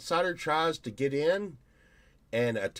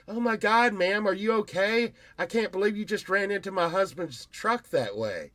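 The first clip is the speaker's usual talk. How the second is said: mock fake concern; 7 semitones higher than usual. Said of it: "Sunshine would get out and be like".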